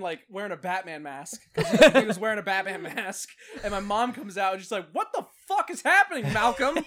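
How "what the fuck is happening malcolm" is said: higher, louder mock outraged mom; a hammed-up mother yelling at the scene; shocked mom. Mom yelling at her son over the fight, mock outraged.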